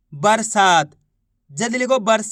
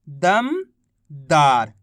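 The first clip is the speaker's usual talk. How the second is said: दमदार drawn out very slowly and lower; slow blended word; 7 semitones lower, much slower than usual. Shown as a demonstration. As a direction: stretch it out long and low. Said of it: "तो मिलके बन गया". (धीरे धीरे खींचकर नीचे स्वर में)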